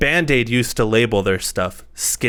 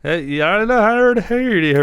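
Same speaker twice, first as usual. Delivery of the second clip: put-on Irish accent; 10 semitones higher than usual. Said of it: "Do your Irish accent".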